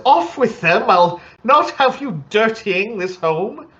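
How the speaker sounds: high, huffy servant scolding; indignant halfling butler. Acted. Halfling butler scolding guests about shoes, high and huffy. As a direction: bristle like a house-proud butler.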